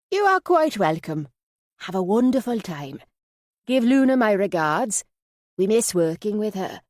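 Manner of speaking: put-on lifted Yorkshire accent, high at first; Minnie. A narrator's reading. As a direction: sign off heartily in a Yorkshire voice. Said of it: "Minnie's Yorkshire accent".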